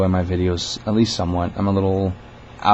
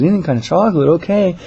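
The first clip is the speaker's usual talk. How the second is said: high, louder repeat; manager humoring a caller; 10 semitones higher, 5 dB louder than usual. Retold or imitated. Manager confirming the caller's candy choice, high friendly voice. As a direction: echo it back brightly, pitched up. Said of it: "She goes".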